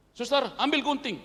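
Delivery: loud, high urgent doctor's command; doctor orders the nurse to fetch scissors, loud and urgent. Retold or imitated. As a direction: bark it to the nurse like a surgeon. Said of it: "Terus dokter bilang".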